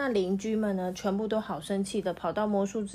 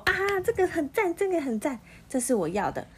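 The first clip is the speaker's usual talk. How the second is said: high, fast burst of joy; loud delighted cry; 9 semitones higher, 4 dB louder, faster than usual. Acted. Magician shouts with joy at his mixed colours. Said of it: "那魔术师就大声说".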